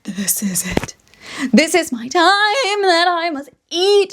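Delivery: lightly voiced demanding cat; a hint of a bossy cat. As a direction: play the cat, slow and insistent. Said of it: "she is just like".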